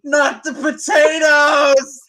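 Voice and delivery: slow, high anguished scream; hammed-up dying scream; screamed last words. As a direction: wail it like a dying man over his potatoes. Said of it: "just screams".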